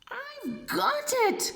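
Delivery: very high squeal of discovery; tiny snail struck by inspiration; excited cry. Acted. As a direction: pop it out like a sudden idea. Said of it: "she cried".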